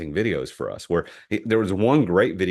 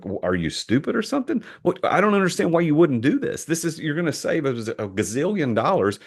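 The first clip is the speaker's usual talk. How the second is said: incredulous, pitch rising high; mock incredulous; 8 semitones higher than usual. Pitching to a doubtful client, mock incredulous and high. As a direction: act baffled that anyone would say no. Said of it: "I would be incredulous".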